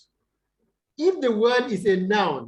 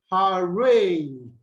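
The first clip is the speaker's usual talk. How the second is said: arRANGE with a long, falling "range"; light first syllable, strong "range"; second-syllable stress; 4 dB louder than usual. Shown as a demonstration. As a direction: stretch the end of arrange. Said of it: "take the stress on the second".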